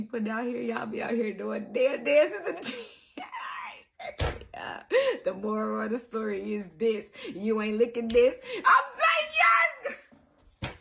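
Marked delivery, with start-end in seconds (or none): 3.2-5.2 squealing high laugh. Real, not acted. Vlogger cracking up about dance trends, squealing laugh. 8.6-10.7 long, high, loud stretched shout; drawn-out yes. Real, not acted. Vlogger cheering on the dancers, long loud yes.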